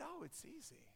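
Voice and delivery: very soft, light and offhand; understated calm. Retold or imitated. Elderly missionary answering the amazed young man, soft understated calm.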